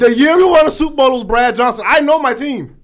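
high, loud heated insistence; angry fan insisting on the facts; heated outburst. Real, not acted. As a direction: shout down the doubters. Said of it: "apologize for my outburst".

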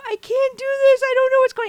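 high, loud, tearful pleading; tearful cry. Retold or imitated. Mom crying to husband from the bathroom, high tearful cry. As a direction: cry the words out, falling apart.

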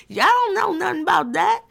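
hamming up a scolding elder. Retold or imitated.

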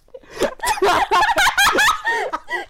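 high, loud cackling laugh; loud burst of laughter. Real, not acted. Big laugh after the bit.

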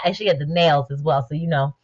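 normal talking voice

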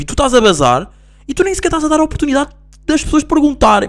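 indignant outburst. Real, not acted. Venting about friend leaving abruptly.

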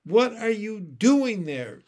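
high, loud, slow challenge; loud, incredulous questioning; demanding. A narrator's reading. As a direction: demand an explanation. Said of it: "he demanded".

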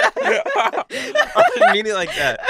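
slow, high, breaking into laughter; laughing hard. Real, not acted. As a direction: barely get it out through laughing. Cracking up at own words.

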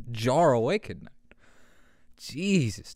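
ordinary talk

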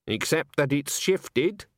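nasty. A narrator's reading. Granny needles her old friend about ageing, nastily. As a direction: draw the insult out with relish. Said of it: "said Granny nastily".

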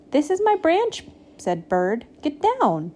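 high, indignant claim; bossy, high-pitched bird; possessive scolding. A narrator's reading. Bird orders Goat off branch.

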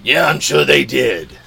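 loud put-on cartoon voice; cartoon character quip. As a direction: toss it off in a goofy character voice. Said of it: "and he's like".

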